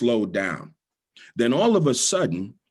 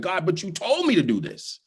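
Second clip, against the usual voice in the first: pleading to God; 10 semitones higher than usual. Retold or imitated.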